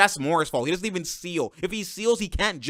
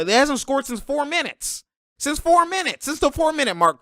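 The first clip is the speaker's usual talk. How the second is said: indignant; 9 semitones higher than usual. Real, not acted. Indignant at a scoring drought.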